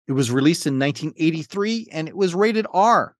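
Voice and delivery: ordinary talk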